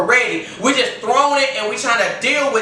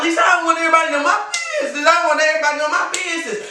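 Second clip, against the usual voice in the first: mock worried listener; 5 semitones higher, much faster than usual. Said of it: "I know you're like".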